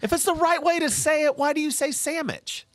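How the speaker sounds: high-pitched, insistent demand; exasperated challenge. Real, not acted. Co-host challenging why he says it, exasperated. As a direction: press the question in high exasperation. Co-host argues over the word.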